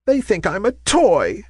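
high, mildly dismayed cry. A narrator's reading. Ballerina thrown in a corner, crying in dismay. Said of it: "she cried in dismay".

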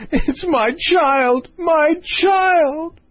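very high, slow, drawn-out wail; hammed-up sob, wailing at the top of the voice; sobbing. A narrator's reading. Queen lamenting her child to the king, sobbing. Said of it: "sobbed Her Majesty".

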